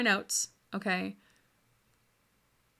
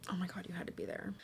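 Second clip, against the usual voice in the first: whispered, quick aside after laughing; hushed aside; 14 dB quieter, much faster than usual. Real, not acted. Vlogger recalling the era to viewers, whispered aside. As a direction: drop to a fast whisper, spent from laughing.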